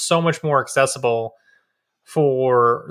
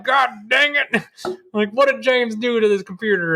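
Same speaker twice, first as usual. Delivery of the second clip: mock exasperated dad; 9 semitones higher than usual. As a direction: imitate the dad grumbling about the meddling. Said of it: "he immediately freaked out".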